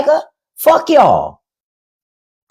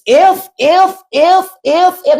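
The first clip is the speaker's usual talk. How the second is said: high mocking "if, if"; 7 semitones higher, slower than usual. Mocking a commenter's "ifs" on her live, in a high voice. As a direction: parrot the word mockingly, pitched up.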